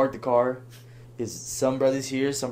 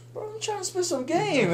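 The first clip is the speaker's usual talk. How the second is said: very high-pitched put-upon complaint; exaggerated falsetto pleading; mock protest. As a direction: squeak out a hammed-up protest to your friends. Protesting to friends who interrupt him.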